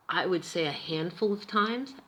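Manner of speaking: normal talking voice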